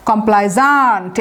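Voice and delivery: slow কমপ্লাইজান্ট, stretched on the final ZANT; pronunciation demo. Shown as a demonstration. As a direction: draw out the z-a-nt ending of কমপ্লাইজান্ট. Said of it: "এটার উচ্চারণটা দেখেন".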